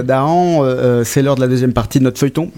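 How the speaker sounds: ordinary talk, nothing marked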